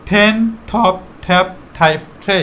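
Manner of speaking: টেন টপ ট্যাপ টাইপ ট্রে, each T with a puff of air; aspirated T. Shown as a demonstration. Teacher gives the new way to say them, aspirated T. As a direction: separate words, breath bursting on every T of টেন টপ ট্যাপ টাইপ ট্রে. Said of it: "এখন থেকে আমরা এটাকে বলবো".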